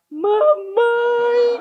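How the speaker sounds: put-on high, loud child's wail; imitating a small boy bursting into tears; toddler wailing for mom. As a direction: cry out "Mamãe!" like a toddler in tears. Toddler cries for his mom.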